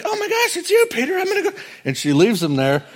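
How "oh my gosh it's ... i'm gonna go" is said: very high, squealing with excitement; giddy servant girl. Retold or imitated. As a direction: gush like a thrilled girl who forgets the gate. Rhoda voiced, thrilled at gate.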